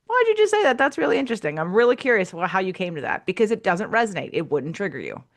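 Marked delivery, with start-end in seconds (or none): 0.1-3.3 bright, high, curious question; curious surprise. Pretend. Observer asking a friend why, mock curious. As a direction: lift up high into playful curiosity. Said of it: "be an observer and be like".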